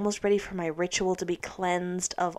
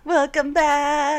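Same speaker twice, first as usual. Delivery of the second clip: drawn out, higher, louder; slow sing-song welcome; 6 semitones higher, 5 dB louder, much slower than usual. Acted. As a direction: echo the greeting slowly. Co-host echoes greeting.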